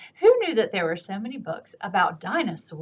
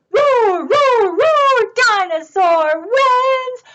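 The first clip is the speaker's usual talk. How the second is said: high roars into a slow victory call; roar, then proclaim the winner; excited storybook triumph; triumphant roaring; 12 semitones higher, 8 dB louder, much slower than usual. Acted. Dinosaur's victory announced.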